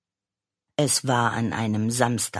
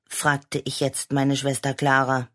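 ordinary delivery

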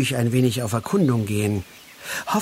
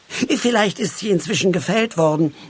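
higher-pitched, breezy reply; offhand; 6 semitones higher than usual. A narrator's reading. Halbschuh answers Moosbart about the spruce, offhand. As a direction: toss it off lightly. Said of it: "erwiderte Halbschuh leichthin".

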